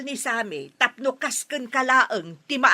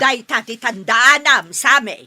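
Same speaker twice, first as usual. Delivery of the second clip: loud, stern warning to the son; 9 dB louder than usual. Acted. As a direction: hammer the warning home. Mother warns son loudly. (napigsa a pakdaar)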